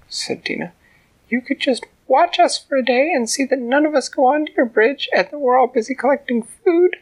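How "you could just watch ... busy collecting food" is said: high, loud little ant; tiny ant voice. A narrator's reading. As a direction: speak up boldly as the tiny ant.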